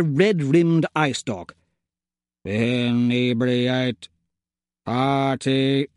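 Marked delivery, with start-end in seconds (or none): 2.4-5.9 slow, lower mechanical robot voice. Acted. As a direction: drag each word like a droid. Robot Toadsworth answers in machine voice.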